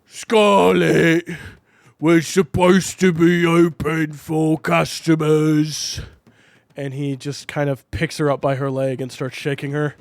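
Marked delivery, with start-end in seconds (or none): 0.0-6.0 louder, slow chef voice; slow, loud cook telling her off; big chef scolding the bartender. Acted. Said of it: "Chef comes out".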